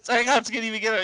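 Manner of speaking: ordinary talk, nothing marked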